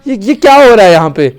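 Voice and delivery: high, loud outraged disbelief; shocked shouting. Real, not acted. Gamer appalled at schoolkids' behaviour on screen, shocked shouting. Player shocked by game scene.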